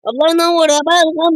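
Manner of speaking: ordinary talk, nothing marked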